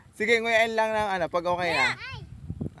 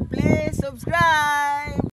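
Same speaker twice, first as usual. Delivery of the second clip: slow drawn-out plea; 3 semitones higher, much slower than usual. Real, not acted. Closing plea to subscribers.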